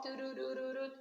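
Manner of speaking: imitated alarm, "doo" repeated on one note; warning siren. Shown as a demonstration. Teacher warning of too many syllables, imitated alarm beeps. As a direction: sound the alarm in quick monotone toots. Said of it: "warning lights must go off".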